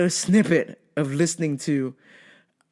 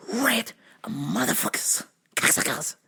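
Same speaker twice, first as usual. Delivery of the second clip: whispered cursing; 3 semitones higher, 5 dB quieter than usual. Retold or imitated. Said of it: "But I was like".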